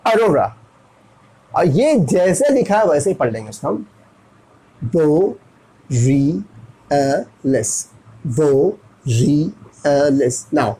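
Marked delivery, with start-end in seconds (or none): 0.1-0.6 "Arora" quick and run together; fast pronunciation. Shown as a demonstration. Teacher shows the everyday speed, "Arora" said quickly. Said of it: "fast में". 4.8-10.5 "दो री अ लेस" slowly, broken into separate syllables. Shown as a demonstration. Teacher breaks the word up for pupils, spaced syllables. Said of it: "तो साथ में होगा".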